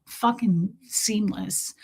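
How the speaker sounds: whispered aside; tiny whispered comment. Real, not acted. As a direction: breathe it out in a whisper.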